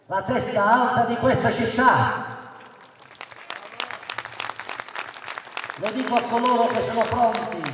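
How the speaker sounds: loud, high declamation; booming balcony speech. Real, not acted. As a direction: hurl it to the piazza. Rousing rally crowd.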